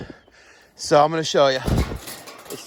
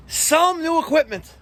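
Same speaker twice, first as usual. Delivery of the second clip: higher-pitched mock viewer comment; imitating viewers; 11 semitones higher than usual. Technician voicing what viewers will say, imitating them. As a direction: chime in as a predictable commenter. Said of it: "what some of you are gonna say".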